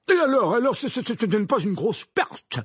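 loud, high, stammering outburst; flaring up. A narrator's reading. Minister brushes off the loss, flaring up. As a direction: burst out, stumbling on the words. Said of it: "s'emporta Fudge".

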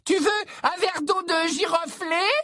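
put-on very high, eager girlish offer; gushing high-pitched admirer; Romilda Vane.

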